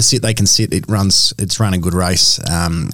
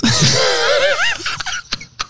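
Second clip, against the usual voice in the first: screechy seagull voice. Pretend. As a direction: shriek like a gull after chips. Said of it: "Can you do a seagull noise?".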